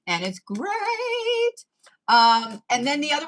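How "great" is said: drawn-out great. Shown as a demonstration. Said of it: "stretched sing-song".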